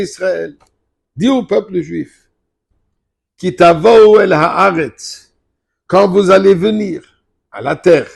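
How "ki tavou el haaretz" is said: usual speaking voice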